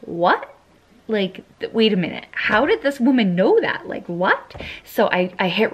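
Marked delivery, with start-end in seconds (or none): 0.0-4.6 climbing, louder, in sheer disbelief; stunned disbelief. Retold or imitated. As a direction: replay the moment it clicked, amazed. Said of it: "And I was like".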